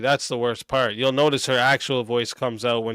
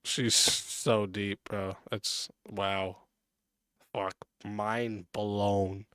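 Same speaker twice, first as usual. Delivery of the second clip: sarcastic awe; 6 semitones lower, 11 dB quieter, much slower than usual. Real, not acted. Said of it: "mocking her deep advice".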